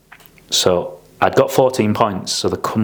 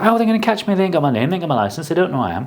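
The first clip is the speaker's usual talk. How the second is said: put-on higher, cocky teen protest; cocky defiance; 9 semitones higher, faster than usual. Teen arguing with his mentor, higher cocky defiance. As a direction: insist nobody can trace him.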